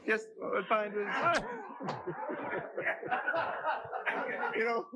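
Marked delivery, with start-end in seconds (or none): 1.1-4.5 a big high burst of laughter. Real, not acted. Laughing in the chamber.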